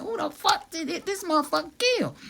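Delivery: very high incredulous squeal, breaking into laughter; shocked disbelief. Retold or imitated. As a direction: retell your horrified question in a squeaky voice. Acts out shock at body.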